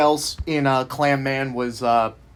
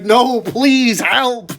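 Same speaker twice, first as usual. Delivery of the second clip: mock screaming; 6 semitones higher, 3 dB louder, much slower than usual. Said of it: "are, like, screaming".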